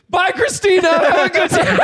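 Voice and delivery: high cheery goodbye to the anchor; cheery goodbye call. Retold or imitated. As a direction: call it out cheerily across the room. Calling out to news anchor.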